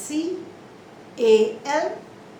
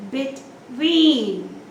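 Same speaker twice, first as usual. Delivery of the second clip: Between as short be, long TWEEN; second syllable stress; 4 dB louder than usual. Shown as a demonstration. Teacher demonstrates the rule, long stress on TWEEN in Between. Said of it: "the stress is on the second syllable".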